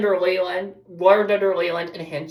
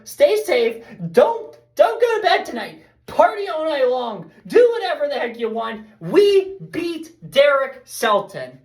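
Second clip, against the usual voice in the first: excited; 8 semitones higher than usual. Real, not acted. Hyped sign-off after the win.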